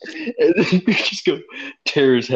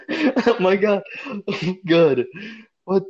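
normal talking voice

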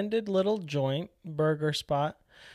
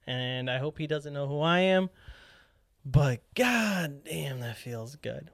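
ordinary delivery

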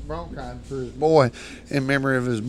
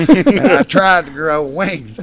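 high, loud, slow telling; excited telling; 4 semitones higher, 8 dB louder, slower than usual. Retold or imitated. Storyteller hams up flapping his arms mid-fall, loud and slow. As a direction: pump up the falling moment.

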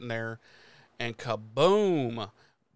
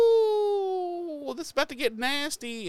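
mock squeal of dread. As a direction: jump way up high into a squeaky mock wail. His own retold reaction to gore.

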